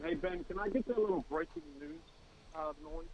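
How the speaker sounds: faint, far from the phone; phone held away. Real, not acted. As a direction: caller off the mic. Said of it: "speak into the phone".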